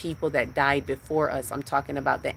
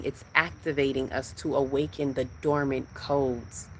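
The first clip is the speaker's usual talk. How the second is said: ordinary delivery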